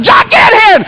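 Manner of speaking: very high, urgent shout; hollered summons. Retold or imitated. Dad calling his son to help, hollering.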